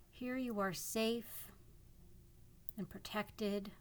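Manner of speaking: calm guiding voice with long pauses; guided meditation. Acted. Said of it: "This is a guided imagery meditation".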